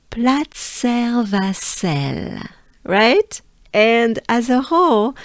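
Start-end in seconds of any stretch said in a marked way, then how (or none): 0.1-2.4 Platzer Vassel slowly in French for repetition; slow French pronunciation. Shown as a demonstration. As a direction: model Platzer Vassel with long, measured syllables. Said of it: "Take a good breath with me".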